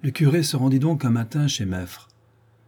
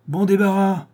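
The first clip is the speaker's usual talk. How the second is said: much higher, louder shout; shouting after her; 9 semitones higher, 4 dB louder than usual. A narrator's reading. Meffre shouting after his daughter as she storms off, a loud yell. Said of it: "m'en cria Meffre".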